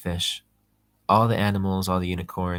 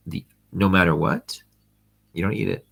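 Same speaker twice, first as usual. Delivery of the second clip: usual speaking voice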